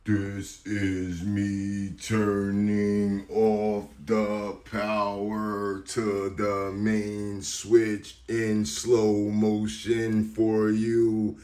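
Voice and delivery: very slow, drawn-out words, held on an even tone; slow-motion voice. Acted. Host showing viewers the power switch-off, exaggerated slow-motion voice. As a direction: stretch every word like slowed-down playback. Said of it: "That's my best slow motion voice".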